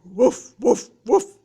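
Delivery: loud, booming woofs; big dog woof. Shown as a demonstration. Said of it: "Some dogs are".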